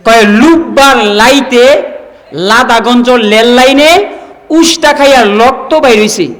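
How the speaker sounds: mock Raipura villager turning r into l; রায়পুরার আঞ্চলিক টানে; Raipura dialect. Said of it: "র কে ল উচ্চারণ করে".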